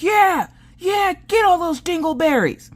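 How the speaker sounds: very high, loud hooting cheer; cheering holler. Acted. Extra mom cheers her kids on, hollering. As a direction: whoop like a parent at the game. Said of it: "that's yelling and hooting and hollering".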